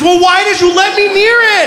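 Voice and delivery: put-on indignant little girl. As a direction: accuse him like an outraged kid. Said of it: "She goes".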